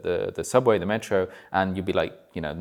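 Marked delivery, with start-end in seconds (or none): none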